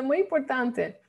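ordinary talk

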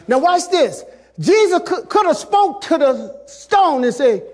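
high, forceful, insistent sermon delivery; full-force preaching. Real, not acted. Preacher on God removing what binds you, full-force preaching. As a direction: drive each item home to the congregation.

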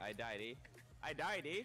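put-on Canadian with a tagged eh; mock Canadian complaint. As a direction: ham up the Canadian eh. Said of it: "the stereotypical Canadian accent".

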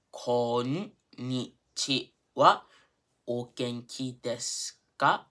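slow, drawn-out syllables for learners; careful slow teaching pace; slow model phrase. Shown as a demonstration. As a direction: stretch each beat, clearly separated. Teacher slows greeting for learners.

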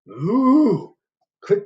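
usual speaking voice

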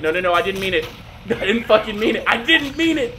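high loud flustered denial; panicked laughing. Real, not acted. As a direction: laugh and protest.

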